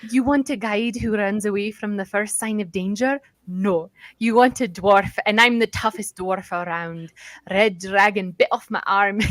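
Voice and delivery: tough dwarf's attempted Scottish brogue; Scottish accent. Dwarf guide boasts he's toughest around, attempted Scottish accent. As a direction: brag like a Scottish dwarf, punching key words. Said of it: "try and do a Scottish accent".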